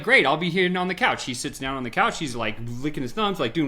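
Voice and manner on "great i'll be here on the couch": higher, louder cheerful clerk; cheerful salesman. Retold or imitated. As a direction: play the happy clerk who'll wait. Salesman agreeing happily.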